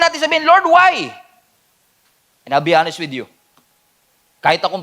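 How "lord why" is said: mock plea. Pastor acting out plea to God.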